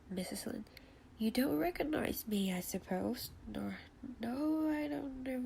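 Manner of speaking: ordinary delivery, nothing marked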